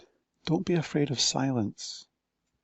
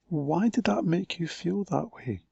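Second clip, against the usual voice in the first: ordinary delivery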